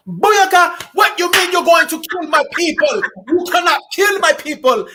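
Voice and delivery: hammed-up Jamaican yard voice, shouting; mock Jamaican gangster. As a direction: burst in yelling like a Jamaican gangster. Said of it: "getting her Jamaica woman on".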